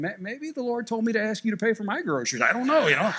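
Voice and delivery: lightly mocking imagined thought; mock inner voice. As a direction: play the old man's imagined sly reply. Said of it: "I'm thinking".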